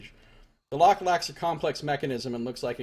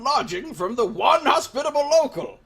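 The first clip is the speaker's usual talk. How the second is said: put-on cranky old man; 12 semitones higher, 7 dB louder than usual. Gamer voicing the old villager, dismissing the soldiers. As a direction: rant like a stubborn old hermit. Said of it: "let's talk to Old Man Mua".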